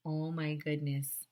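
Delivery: ordinary talk, nothing marked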